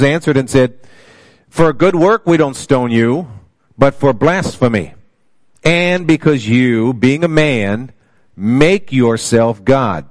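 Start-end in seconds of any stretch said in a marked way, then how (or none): 1.5-10.0 raised, measured Bible reading; scripture reading. Acted. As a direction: read the verse out to the congregation with slow weight. Reads scripture aloud.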